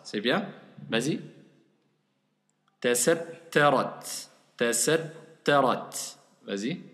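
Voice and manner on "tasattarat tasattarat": "Tasattarat" slowly, the ta held; slow syllable drill. Shown as a demonstration. Teacher slowly models "Tasattarat" to student. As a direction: stretch each syllable of "Tasattarat". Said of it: "il est resté sur le ta".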